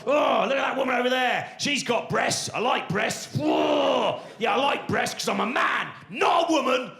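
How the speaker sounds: lampoon a gym-going alpha male; macho bloke parody. Retold or imitated. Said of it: "This whole kind of like, you know, the whole".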